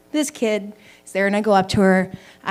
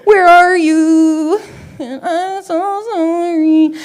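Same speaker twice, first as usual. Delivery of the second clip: slow drawn-out call; 8 semitones higher, 4 dB louder, much slower than usual. Real, not acted. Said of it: "Who said that?".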